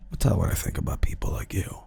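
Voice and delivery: low, quick, threatening whisper; menacing whisper. Retold or imitated. Intruder with hands on the streamer's shoulders, whispering menace. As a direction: play a sinister intruder whispering close.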